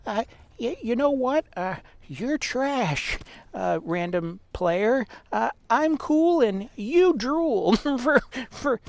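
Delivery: high, slow whiny troll voice; mock a whiny basement gamer kid; raging gamer. Mocking imagined basement troll gamer.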